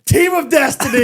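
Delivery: high, loud triumphant shout; excited shout. Real, not acted. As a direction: shout it like a victory chant. Hyped-up golf win celebration.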